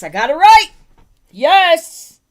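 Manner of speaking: loud, high-pitched cheer; triumphant. Real, not acted. Streamer celebrates getting the spelling right, triumphant. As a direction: celebrate with a rising, loud burst.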